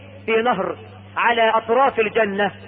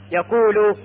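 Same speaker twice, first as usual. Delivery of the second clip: usual speaking voice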